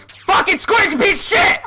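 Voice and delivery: high, loud cursing; angry outburst. Real, not acted. Gamer cursing the squares he failed to jump, angry outburst. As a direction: explode at the game. Cursing the game after failing.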